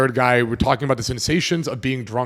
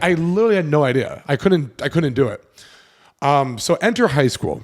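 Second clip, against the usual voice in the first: normal talking voice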